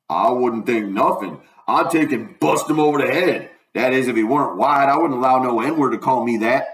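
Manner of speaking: lightly voiced indignant dialect character; a touch of Southern indignation; Jim, offended. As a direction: bristle mildly in old Southern dialect.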